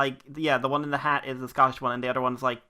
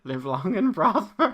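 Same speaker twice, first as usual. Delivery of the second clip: mock Vulcan; 5 semitones higher, 4 dB louder, slower than usual. Vulcan salute quoted.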